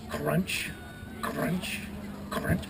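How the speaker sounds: soft, suspenseful crunch noises; ominous crunching. Acted. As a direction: drop your voice and leave gaps between crunches. Imitates a sound heard underwater.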